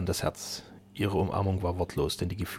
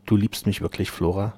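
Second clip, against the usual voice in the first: plain reading voice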